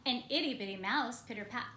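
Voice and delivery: usual speaking voice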